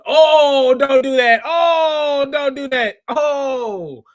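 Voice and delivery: loud, high, long-drawn "oh"; mock-scandalized outcry. Host reacting to a cheeky chat comment, mock-scandalized outcry. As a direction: wail in playful protest.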